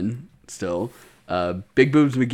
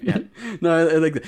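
normal talking voice

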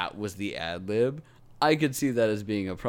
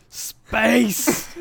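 mock exclamation; 12 semitones higher, 7 dB louder than usual. Mocking Destiny's moon wizard plot.

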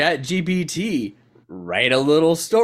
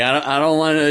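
ordinary talk

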